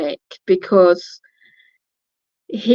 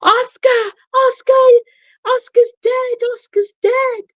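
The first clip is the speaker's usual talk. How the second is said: high wailing cry of grief, imitated; keen the name like someone in shock; mock sobbing, much higher, over a dead dog. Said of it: "crying and saying".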